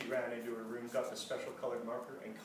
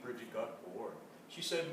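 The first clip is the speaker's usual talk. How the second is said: ordinary delivery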